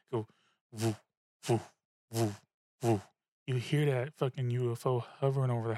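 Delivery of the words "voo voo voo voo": short, evenly spaced "voo" pulses; imitated UFO hum. Retelling the UFO hovering over the house, imitated hum. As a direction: mimic a hovering craft in separate pulses. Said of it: "I hear it hovering over the house".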